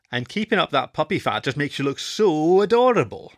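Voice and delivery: put-on high, loud baby-talk tease; sarcastic gushing, swelling toward the end; mock cooing retort. A narrator's reading. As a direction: coo mockingly as if pinching a puppy's cheeks. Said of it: "making a mock cooing noise".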